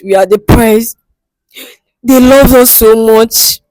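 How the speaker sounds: ordinary delivery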